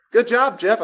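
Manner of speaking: loud, high cheer; celebrating Jeff. Retold or imitated. Praising friend Jeff for saying no, loud happy cheer. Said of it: "My friend Jeff said no".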